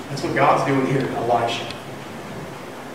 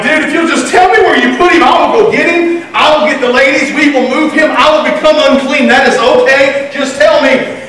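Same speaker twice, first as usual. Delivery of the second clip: high-pitched, insistent pleading; acting out a distraught woman begging; desperate plea; 8 semitones higher than usual. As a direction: plead urgently in a raised pitch. Acts out Mary's distraught plea to gardener.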